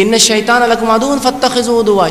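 slow chanted Arabic verse; آہستہ تلاوت; Quran recitation. Acted. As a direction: intone each word deliberately.

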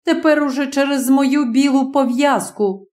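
usual speaking voice

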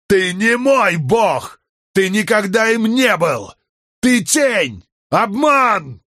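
very high, angry shouting; furious denunciation. A narrator's reading. Man denies his god, furious high shouting. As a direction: hurl each accusation as a shout.

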